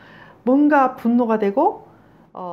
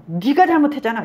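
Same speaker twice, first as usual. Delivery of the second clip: louder, higher imitation of blaming; mock accusation; 5 semitones higher, 5 dB louder than usual. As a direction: pin the fault on them, acted out. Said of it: "나의 수치심을 딱 자극하는 거잖아".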